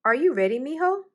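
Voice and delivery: put-on a cheerful dad calling to his boy; upbeat morning call from dad to son; fatherly call. A narrator's reading.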